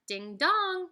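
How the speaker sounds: plain reading voice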